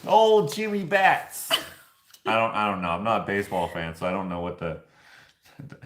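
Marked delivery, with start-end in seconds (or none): 0.1-1.5 put-on slow, higher, louder New York wiseguy accent; old-timey nickname. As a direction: announce the nickname like a gangster. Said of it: "old-timey nicknames".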